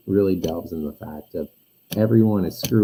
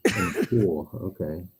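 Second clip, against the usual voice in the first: muffled, far-off mic sound; like talking under water; 6 semitones higher than usual. Real, not acted. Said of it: "it sounds like you're in the pool".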